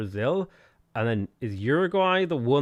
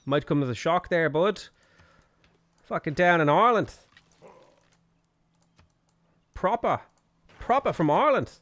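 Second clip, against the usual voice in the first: hammed-up, higher-pitched mock Australian; shitty Australian accent; 5 semitones higher, 5 dB louder than usual. Streamer jokingly insists he's Irish to a viewer, bad Australian accent. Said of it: "There's a shitty Australian accent".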